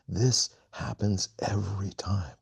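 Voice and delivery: a slow, knowing whisper; hushed voice of the leader. Retold or imitated. Joanna explaining after the ritual.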